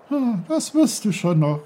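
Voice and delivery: high, loud lilting babble; the stream half singing; singsong brook. Acted. The brook sings encouragement to Peter. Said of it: "sang der Bach".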